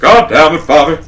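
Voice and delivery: high, loud cartoon dad impression; Hank Hill. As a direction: push the put-on voice high and loud. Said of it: "I lost it again".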